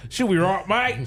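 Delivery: Australian, way up in pitch, mocking the aliens; hammed-up Australian accent. Mocking the Takrit aliens as Australians, high Aussie voice. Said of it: "They must be Australian".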